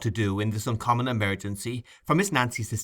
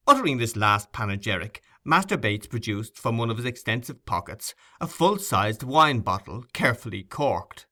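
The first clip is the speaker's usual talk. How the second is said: usual speaking voice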